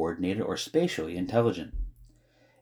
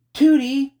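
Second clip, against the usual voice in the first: high, loud call; shout; 9 dB louder than usual. A narrator's reading. Girl calling a neighbor for help, urgent shout. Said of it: "shouted Flora".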